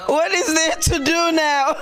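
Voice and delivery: laughing disbelief. Retold or imitated. Asking the TV what to do now, slow and laughing. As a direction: ask it through a laugh, half amused. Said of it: "I'm like".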